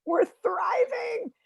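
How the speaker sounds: high, slow, drawn-out shout of triumph; mock triumphant cry. Comic to the audience, a slow, high triumphant shout. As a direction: belt it out like a victory cry.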